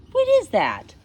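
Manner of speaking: high, loud coo at the dog; asking the dog about the leaf on its tongue, high and cooing. Real, not acted. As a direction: coo it to the puppy.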